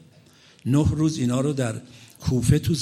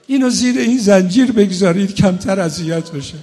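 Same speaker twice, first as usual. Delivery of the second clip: louder, higher preaching in a grieving build; impassioned mourning sermon; 7 semitones higher, 7 dB louder than usual. Acted. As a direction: push the lament toward the climax. Emotional rowzeh at rising force.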